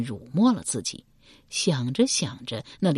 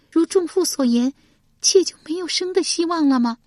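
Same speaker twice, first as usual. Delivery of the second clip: tearful plea; 13 semitones higher, 5 dB louder than usual. A narrator's reading. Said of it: "蔡新又哭道".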